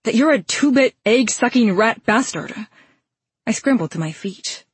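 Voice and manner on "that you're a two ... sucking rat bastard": higher, spirited angry insult; angry retort. A narrator's reading. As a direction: hurl the insult back at him. Heroine insults villain in fight.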